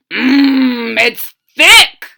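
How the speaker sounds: soldier voice. Acted. First soldier tasting the soup, loud and pleased. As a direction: savour it out loud. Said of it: "said the first".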